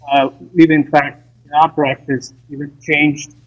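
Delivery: call dropping out. Real, not acted. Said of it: "we're having difficulties".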